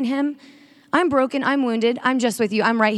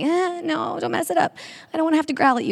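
much higher, playful defensive voice; mock possessive refusal; 8 semitones higher than usual. Speaker playing herself refusing God her plans, mock high whine. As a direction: squeak it out like clutching your plans. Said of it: "And I'm like".

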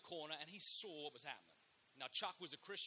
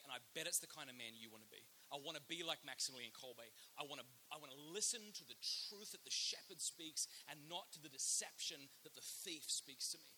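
ordinary delivery

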